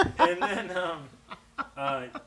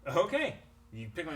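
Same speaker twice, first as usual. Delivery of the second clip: usual speaking voice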